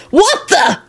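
high startled cry; startled yell. A narrator's reading. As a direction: shock at the sky. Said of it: "Lupin yelled".

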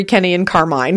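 plain reading voice

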